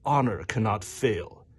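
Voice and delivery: grand, proclaiming read with swooping high pitch; text reading voice. Acted. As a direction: intone it like a solemn warrior creed, drawing out the line endings. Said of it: "get my text reading voice".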